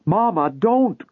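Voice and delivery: high, drawn-out plea; desperate cry. A narrator's reading. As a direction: cry out to stop her. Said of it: "cried the younger daughter".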